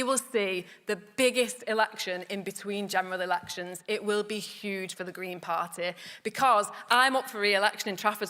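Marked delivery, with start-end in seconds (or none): none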